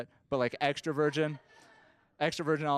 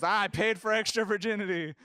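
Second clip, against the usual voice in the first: indignant complaint; 8 semitones higher, 4 dB louder than usual. Retold or imitated. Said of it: "Which is weird to say to strangers".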